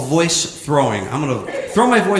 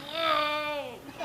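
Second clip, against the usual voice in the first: high, soft voice muffled in a hand; muffled far voice; 11 semitones higher, 11 dB quieter, much slower than usual. Shown as a demonstration. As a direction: make it sound trapped far away. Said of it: "you hear".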